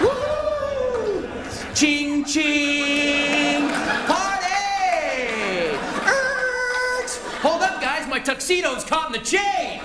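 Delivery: high, whooping party yells; mock party whoops. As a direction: ham up a wild prom ride on a bike. Mock prom ride on handlebars.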